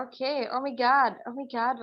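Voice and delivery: slow, drawn-out posh exclamation fading away; mock English accent gasp; posh oh my god. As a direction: drawl it like a show-off. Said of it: "OMG वाला".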